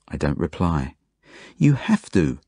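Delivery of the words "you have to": higher-pitched insistence; insistent. A narrator's reading. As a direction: insist like a pushy child. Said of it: "insists Zelda".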